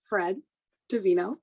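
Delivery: ordinary talk, nothing marked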